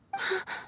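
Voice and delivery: sharp startled gasp; a quick breathless gasp. Acted. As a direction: catch the breath in surprise. Startled at own outburst.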